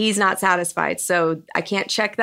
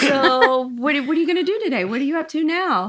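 cheerful helper voice; 6 semitones higher than usual. Retold or imitated. Wife acting cheerful helpful husband.

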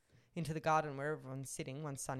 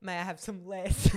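a louder mock orphan's plea; a hint of Oliver Twist, louder; repeated plea; 3 semitones higher, 10 dB louder than usual. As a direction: say it again, savouring the joke. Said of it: "You just missed a good one".

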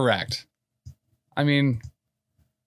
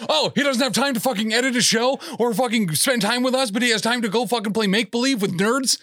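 mock angry rant; 9 semitones higher than usual. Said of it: "He's like".

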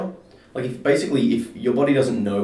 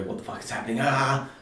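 hushed question rising into a higher cry; mock panic; 6 semitones higher than usual. Man shows friend how refusing acceptance goes, mock panic. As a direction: act out a mild freak-out. Acting out panicked reaction to an attack.

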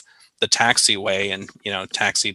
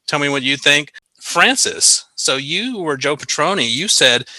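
ordinary talk, nothing marked

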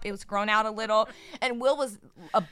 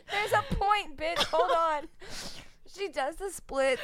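laughing; 7 semitones higher, 4 dB quieter than usual. Real, not acted. Laughing while telling story.

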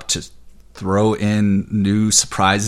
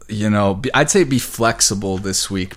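normal talking voice

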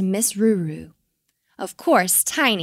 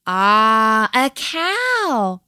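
murmuring in wonder; 5 dB louder, much slower than usual. A narrator's reading. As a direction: realise it together with a big, lifted sigh. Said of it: "murmured the Kiwis".

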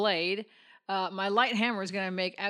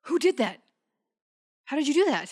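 startled; 5 semitones higher, 5 dB louder than usual. Acted. Door rises by itself.

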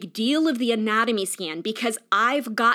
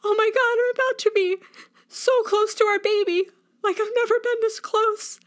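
high, tearful rush of emotion; teary overwhelmed; 12 semitones higher than usual. Retold or imitated. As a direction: relive the moment of happy tears. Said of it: "I just burst into tears".

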